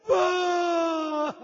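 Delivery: very slow, high crying vay; drawn-out sob. Acted. Preacher sobs to the mourning hall, slow wail.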